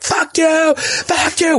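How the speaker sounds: high, vengeful swearing at the toilet; retold angry yell. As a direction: spit it out in mock fury. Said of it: "I was like".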